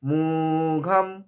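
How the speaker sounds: "মোঘম" slowly, long first vowel, closed m; m ending. Shown as a demonstration. Teacher showing the ending, slow "মোঘম" with m. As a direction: stretch "মো", end "মোঘম" on m. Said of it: "অনুস্বরটা ময়ের উচ্চারণ হবে".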